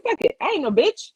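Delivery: high and loud, daring the room; no fear, jump in; bold bravado. Retold or imitated. Telling friends how she dared the room, cocky and loud. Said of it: "And I was just like".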